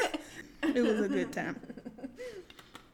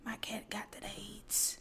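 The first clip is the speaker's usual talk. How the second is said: whispered imitation; 12 dB quieter than usual. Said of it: "She loved to come in and tell everybody".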